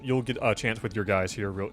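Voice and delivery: ordinary talk, nothing marked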